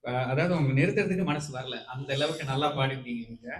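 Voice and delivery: usual speaking voice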